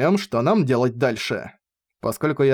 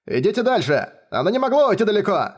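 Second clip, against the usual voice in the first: high rough shout down the corridor; 7 semitones higher than usual. A narrator's reading. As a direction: call out to the group. (грубый крик)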